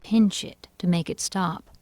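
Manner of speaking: ordinary delivery, nothing marked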